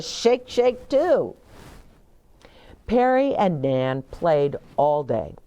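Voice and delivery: ordinary delivery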